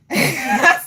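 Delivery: sudden breathy burst of laughter; short laugh. Real, not acted. Streamer amused by airport advice, short burst of laughter. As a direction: crack up briefly, high and loud.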